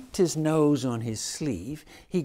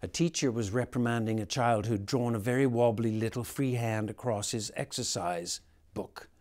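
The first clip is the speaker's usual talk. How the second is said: ordinary delivery, nothing marked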